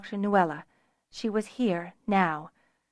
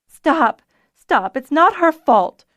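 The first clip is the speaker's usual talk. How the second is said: high, loud urgent protest; crying out; 7 semitones higher, 9 dB louder, slower than usual. A narrator's reading. Nuella defends Fiona from Zennor, crying out. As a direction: cry out to halt an attack. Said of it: "Nuella cried".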